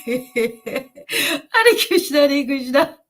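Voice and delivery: high, tearful laughter through the chant; laughing and crying. Real, not acted. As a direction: chant while overcome with laughing tears. Ecstatic laugh-cry while chanting.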